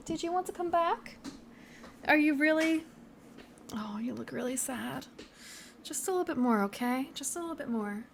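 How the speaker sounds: soft high pet talk, almost a whisper; cooing to the cat. Real, not acted. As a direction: coax the sad cat, gently and up high.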